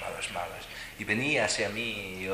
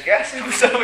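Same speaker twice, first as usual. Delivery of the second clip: alarmed cry; 12 dB louder than usual. Retold or imitated. Amigas alarmadas ante hombres armados.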